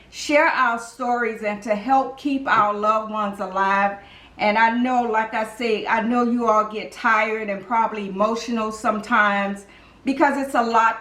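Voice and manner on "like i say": ordinary talk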